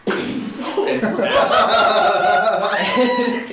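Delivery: laughing through the words; cracking up mid-sentence. Real, not acted.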